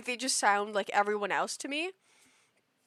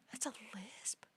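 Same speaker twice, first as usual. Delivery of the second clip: breathless disbelieving whisper of the question; stunned whisper; 19 dB quieter than usual. Retold or imitated. Telling a friend about the lisp, stunned whisper. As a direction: whisper it as if the floor dropped out. Retelling own shocked reaction.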